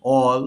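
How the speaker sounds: অল with an "aw" vowel, "awl". Shown as a demonstration. Teacher giving an example of A, অল said "awl". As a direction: sound the A in অল as "aw". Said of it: "এর উচ্চারণ স্বরেও হয়".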